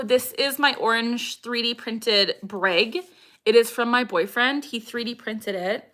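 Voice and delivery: usual speaking voice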